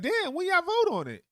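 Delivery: a hammed-up shocked citizen; mock baffled citizen. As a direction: react like you just found out. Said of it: "We're like".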